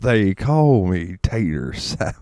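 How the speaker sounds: slow, drawn-out comedian catchphrase; imitating a stand-up's slow delivery. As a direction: draw every word out lazily.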